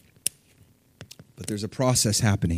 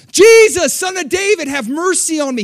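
high, loud plea with a long-held first word; crying out loud; 8 dB louder than usual. Retold or imitated. Blind beggar crying out again to Jesus, loud plea. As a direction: shout the name over everyone. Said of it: "He cries out".

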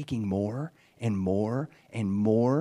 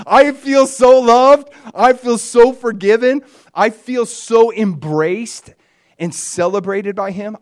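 higher, louder and slow; slow, joyful swelling exclamation; overflowing joy; 8 semitones higher, 11 dB louder, slower than usual. Real, not acted. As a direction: let the joy spill over. Overwhelmed by God's love.

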